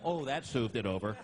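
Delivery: ordinary talk, nothing marked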